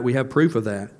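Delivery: ordinary talk, nothing marked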